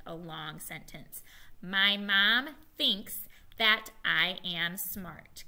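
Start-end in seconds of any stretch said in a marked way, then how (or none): 1.6-5.4 each word spaced out slowly. Shown as a demonstration. Teacher reading the sentence slowly to the class, word by word. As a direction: model it slowly so the class can follow. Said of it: "Can you say it with me".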